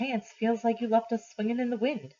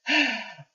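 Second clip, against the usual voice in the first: heavy weary sigh; grandmother's big sigh; 4 semitones higher than usual. Acted. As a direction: let out a long tired sigh. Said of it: "Gran says with a big sigh".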